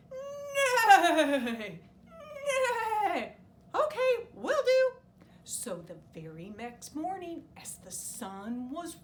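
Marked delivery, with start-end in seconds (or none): 0.1-3.4 loud whinnying neigh; horse neigh. Acted. 3.7-4.9 high, loud panicky rooster; a flustered little bird begging; squeaky rooster. Acted.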